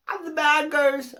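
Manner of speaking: ordinary talk, nothing marked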